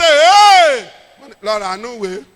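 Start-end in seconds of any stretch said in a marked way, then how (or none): 0.0-0.9 long, high shouted cheer, imitating a crowd; drawn-out loud whoop; crowd's roar. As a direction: roar it out like an audience reacting.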